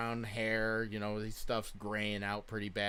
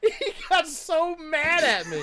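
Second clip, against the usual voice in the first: laughing through the words, pitched high; can barely get it out for laughing; 11 dB louder, slower than usual. Real, not acted. Laughing while retelling dad's anger.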